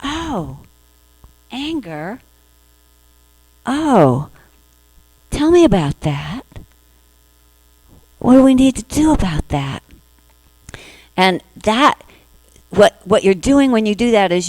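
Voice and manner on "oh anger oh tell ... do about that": tender welcoming. Retold or imitated. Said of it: "But if you say".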